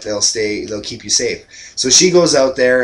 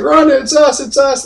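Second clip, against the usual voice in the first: much higher, louder, put-on kid voice; kid reassuring Rhonda; 14 semitones higher, 6 dB louder than usual. Kids calling to dazed Rhonda after the prank, high kid voice. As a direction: squeak it out fast to calm her down. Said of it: "Schrader's like".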